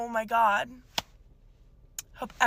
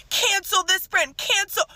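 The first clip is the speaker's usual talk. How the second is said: mocking quote; 7 semitones higher than usual. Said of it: "people calling it".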